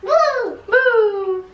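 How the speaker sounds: high excited whoops; cheering. Real, not acted. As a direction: give a little cheer.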